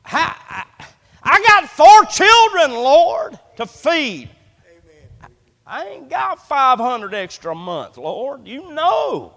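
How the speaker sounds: pleading with the Lord over the car insurance; retold desperate prayer. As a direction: cry out to God about the bills, then fade. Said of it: "I said".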